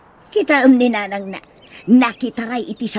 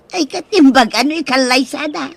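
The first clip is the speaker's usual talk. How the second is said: ordinary talk, nothing marked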